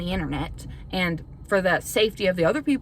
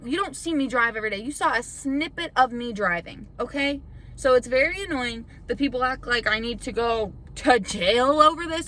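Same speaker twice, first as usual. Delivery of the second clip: ordinary delivery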